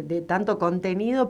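usual speaking voice

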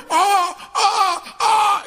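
high pained yell of a patient; grito de dolor. Retold or imitated. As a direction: scream out in mock agony at the dentist. El negro grita de dolor. (grito fingido de dolor en el dentista)

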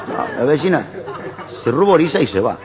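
ordinary talk, nothing marked

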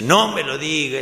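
loud acted plea from within; exasperated protest. Hidden man interrupting woman's confession, loud exasperated plea. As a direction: burst out, begging not to hear it.

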